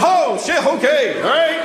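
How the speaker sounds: startled reaction. Retold or imitated. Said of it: "they all went".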